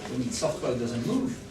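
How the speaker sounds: ordinary delivery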